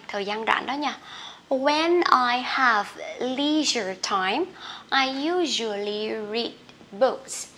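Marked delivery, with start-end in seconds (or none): 1.5-7.5 measured phrase-by-phrase dictation; slow model reading. Shown as a demonstration. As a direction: read slowly so learners can follow. Teacher models example sentence slowly. (đọc mẫu chậm, rõ từng cụm)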